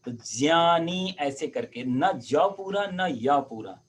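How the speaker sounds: ज्ञानी slowly, a light touch of both ज and य; careful slow ज्ञानी. Shown as a demonstration. Teacher explaining the blended ज्ञ slowly. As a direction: ease into ज्ञानी slowly, neither full ज nor full य. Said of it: "हल्का सा दोनों का टच लेकर के बोला जाता है".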